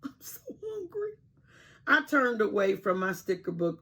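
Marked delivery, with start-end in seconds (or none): 0.0-1.1 high, soft whimpering moan; hungry moan.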